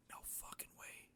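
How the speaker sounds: unvoiced whisper of shock; whispered disbelief. Real, not acted. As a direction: whisper it under your breath. Shocked by friend's arrival.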